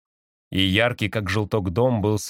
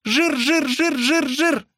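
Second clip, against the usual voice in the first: very high, repetitive pigeon voice; cooing pigeon; much slower than usual. A narrator's reading. Pigeon fixated on food, cooing high. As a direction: chant it greedily like a pigeon. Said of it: "заворковал голубь".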